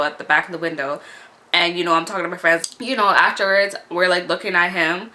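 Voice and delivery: ordinary delivery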